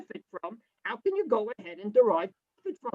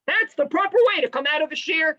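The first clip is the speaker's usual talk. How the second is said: usual speaking voice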